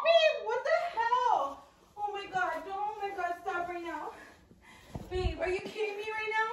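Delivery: high, strained disbelief; freaking out. Real, not acted. Girlfriend freaking out at cockroaches on her face, high alarm.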